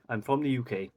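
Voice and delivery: ordinary talk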